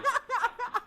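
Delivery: high-pitched helpless laughter; high squealing laugh. Real, not acted. Streamer cracking up at the clip with a high-pitched squeal.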